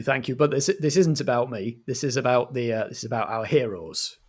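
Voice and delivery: usual speaking voice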